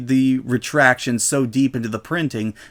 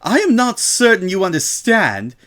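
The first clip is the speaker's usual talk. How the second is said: higher, clipped barely-held fury; strained anger; 7 semitones higher than usual. A narrator's reading. Shop owner to Rainbow, tight and furious. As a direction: force the words past rising rage. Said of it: "veins began to stand out".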